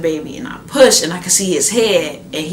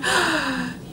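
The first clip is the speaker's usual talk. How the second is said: gasp of joy; 9 semitones higher than usual. Retold or imitated. Seeing baby boy.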